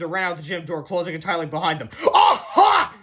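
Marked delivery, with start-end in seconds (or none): 2.1-2.9 high, loud gotcha shout; gloating yell. A narrator's reading. Mister Crump catching the boys, high loud gloating yell. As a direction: shout like you caught them. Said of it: "yelled Mister Crump".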